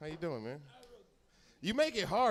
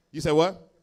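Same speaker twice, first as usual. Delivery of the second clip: ordinary talk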